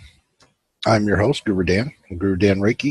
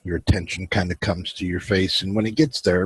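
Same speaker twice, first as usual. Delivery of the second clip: guided meditation; 3 dB quieter than usual. Acted. Host guiding his viewers into relaxation, calm guided meditation. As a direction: ease the listeners into stillness.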